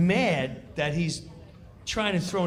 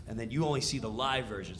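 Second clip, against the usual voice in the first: normal talking voice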